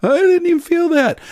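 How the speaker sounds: mock surprise. Preacher voicing a child amazed the shot is done. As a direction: play the kid surprised it's over.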